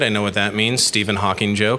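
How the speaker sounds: normal talking voice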